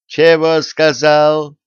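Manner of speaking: can't believe what he just heard; astonished. A narrator's reading. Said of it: "изумился царь". (царь изумлён предложением палача)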